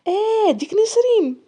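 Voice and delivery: high, drawn-out sneer; scornful recognition, stretched out and high. A narrator's reading. Sahar scoffs about Nesrine to Louay, sneering. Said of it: "طلقات ضحكة ساخرة".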